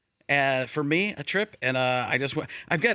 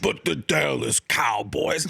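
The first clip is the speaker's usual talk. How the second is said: slow, low, shouted Dallas Cowboys; Michael Irvin impression; 5 semitones lower, slower than usual. Imitating Michael Irvin on the show, slow and low. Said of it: "I tried to work in a Michael Irvin".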